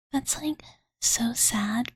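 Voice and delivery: ordinary talk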